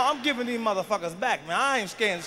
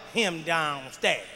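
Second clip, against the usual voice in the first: put-on quieter, slow kid voice; mock kid voice; 7 semitones lower, 4 dB quieter, much slower than usual. Boy tattling to his mother, slow and quiet. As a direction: drag the words out like the boy. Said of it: "He gonna tell her".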